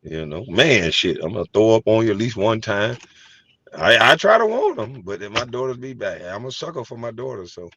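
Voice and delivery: ordinary talk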